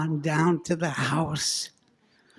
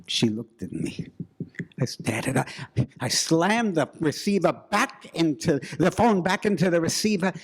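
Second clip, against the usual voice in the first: ordinary delivery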